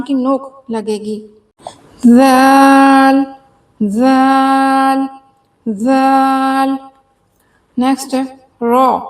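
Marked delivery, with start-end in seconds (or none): 2.1-6.8 slow drawn out zaal. Shown as a demonstration. Teacher drilling जाल slowly for students. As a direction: stretch जाल long and slow. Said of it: "ऊपर वाले जो दो दांत हैं".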